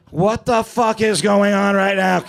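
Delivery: loud, high, slow disbelieving outcry; shocked crowd. Retold or imitated. Comic voicing the stunned audience at the Improv, loud shocked cry. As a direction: spell out the crowd's alarm. Crowd reacting to mock stabbing.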